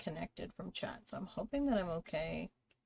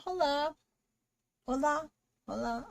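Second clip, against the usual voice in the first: tries Spanish; 7 semitones higher, 7 dB louder than usual. Real, not acted. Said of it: "I am not good with languages".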